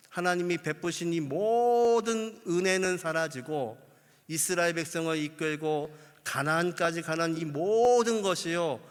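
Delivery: ordinary talk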